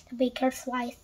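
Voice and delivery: plain reading voice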